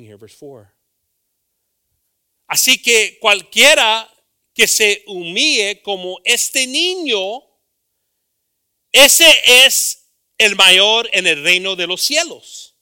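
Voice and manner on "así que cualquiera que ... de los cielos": loud, high Spanish scripture read; preaching Bible reading. Acted. Preacher reading scripture aloud to congregation, loud. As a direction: proclaim the verse to the church.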